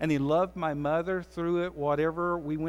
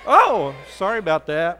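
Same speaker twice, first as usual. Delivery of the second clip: mock startled; 10 dB louder than usual. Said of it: "Someone's really getting on to me".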